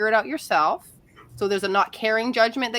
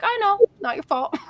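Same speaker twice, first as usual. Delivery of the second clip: higher, sing-song consoling voice; high mock comfort to a client; mock sympathetic; 10 semitones higher than usual. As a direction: pretend to soothe, pitched up. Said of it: "I'm like".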